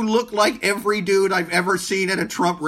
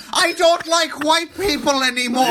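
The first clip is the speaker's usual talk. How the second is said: loud, higher mock quote of her; 8 semitones higher, 4 dB louder than usual. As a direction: shout it like a snotty celebrity. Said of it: "I go".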